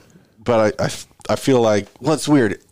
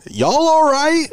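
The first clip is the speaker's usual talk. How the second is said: high mock Texas drawl for the girl; southern girl; much slower than usual. Girl asking after the crash in a high drawl. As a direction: imitate her high and worried. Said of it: "just like".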